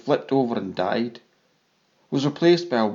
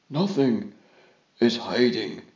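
plain reading voice

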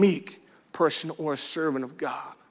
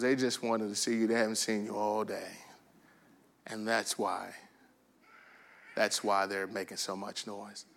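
low, soft, patient explanation; hushed, tender imitation of a wife; gentle wife; 6 semitones lower, 9 dB quieter than usual. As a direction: soothe with grace and meekness. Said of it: "with all grace and mercy and meekness".

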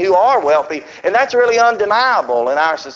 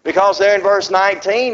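ordinary talk, nothing marked

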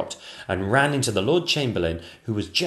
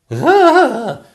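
high, loud exclamation; a queen's startled cry; 13 dB louder than usual. Acted. Queen comes upon the statue.

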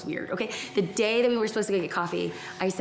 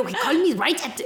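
put-on German accent, pitched a bit higher; mock German accent; 4 semitones higher than usual. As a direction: put on a playful caricature of German speech. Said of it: "in my head, that's how he talks".

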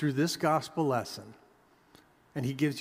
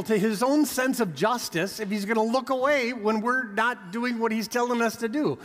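higher, louder fervent sermon voice; preaching at full force; 6 semitones higher, 3 dB louder than usual. Acted. Preacher challenging his congregation, higher and louder. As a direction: press the congregation hard from the pulpit.

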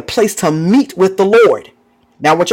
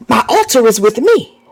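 ordinary delivery

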